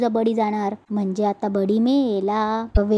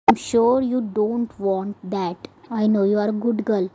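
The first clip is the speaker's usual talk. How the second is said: menacing slow threat; much slower than usual. Acted. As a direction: lean in and threaten her slowly, coldly sweet. Stepmother threatening the child.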